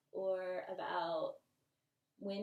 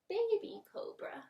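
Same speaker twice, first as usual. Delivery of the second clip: put-on baby voice; 12 semitones higher, 4 dB louder than usual. Shown as a demonstration. Baby version of cobra.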